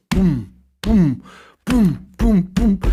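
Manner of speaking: deep heavy thuds, boom after boom; imitated footsteps booming. Storyteller imitating the thuds of Larry running, deep booms. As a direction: stomp it out like a heavy man running on a car roof. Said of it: "it sounds like this".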